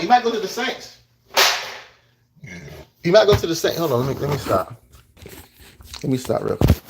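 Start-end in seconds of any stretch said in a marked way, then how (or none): 0.0-3.8 higher and louder, getting hyped; excited prediction. Real, not acted. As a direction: blurt the guess with rising excitement.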